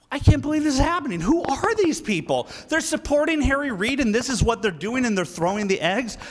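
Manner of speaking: high-pitched, tumbling outburst easing down; agitated disbelief. Retold or imitated. Speaker retelling his shock at the union crowd, agitated disbelief. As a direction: start high and fast, aghast.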